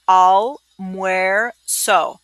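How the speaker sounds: "almuerzo" stretched very slowly, each syllable apart. Shown as a demonstration. Teacher slows a tough word down for the class, syllable by syllable. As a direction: break "almuerzo" into slow separate syllables. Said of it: "That's a tough one".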